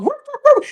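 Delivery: squeaky high whoop whoop, playful; high whooping dog noise. Pretend.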